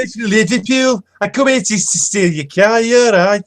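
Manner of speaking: very high, slow mock Liverpool accent; hammed-up Scouser from Liverpool; exaggerated Liverpool accent. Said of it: "especially if they're like this".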